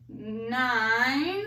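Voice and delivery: ordinary talk, nothing marked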